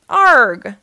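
loud frustrated arg; frustrated groan. A narrator's reading. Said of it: "groaned June".

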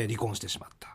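whispered. Real, not acted. Drops voice on divorce fact.

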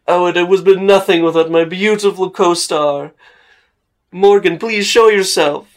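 high, loud theatrical flourish; pompous actor. Acted. Actor summoning the shy co-star, loud and pompous. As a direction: present a co-star with grand ceremony. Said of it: "he's so pompous looking".

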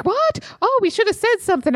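high-pitched, startled imitation of oblivious friends; mock clueless surprise. Friends who missed the catcalling answer her, mock startled. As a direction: squeal the what, then hurry on high.

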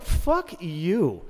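high, pointed "Fuck you"; retorted insult. Retold or imitated. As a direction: spit it back at them. Retorting to fake friends.

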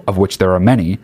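ordinary talk

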